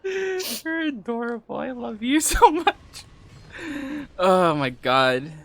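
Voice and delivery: high, slow, gushing and adoring. Real, not acted. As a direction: melt over how cute they are. Gushing over partner.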